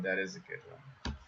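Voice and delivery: soft mumbled repeat; muttered agreement under the breath; quiet echo. Real, not acted. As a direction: murmur it to yourself. Echoed quietly to himself.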